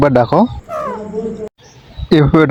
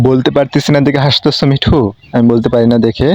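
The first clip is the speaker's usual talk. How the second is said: normal talking voice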